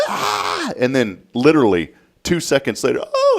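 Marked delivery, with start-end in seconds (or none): 0.1-0.7 high mock shriek; child's scream. Dad imitates daughter as the wheel starts, high scream. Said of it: "as soon as it started going". 3.0-3.4 put-on high, surprised child's ooh; delighted gasp. Dad imitates daughter starting to enjoy it, high delighted oh. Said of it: "and she started laughing".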